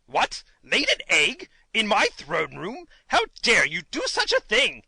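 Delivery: acted fury, punching the words; furious king. A narrator's reading. King outraged at an egg in his throne room, furious. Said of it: "in a voice of fury".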